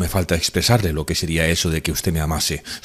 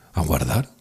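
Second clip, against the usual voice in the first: usual speaking voice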